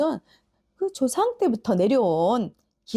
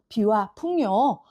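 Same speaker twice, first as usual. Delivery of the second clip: usual speaking voice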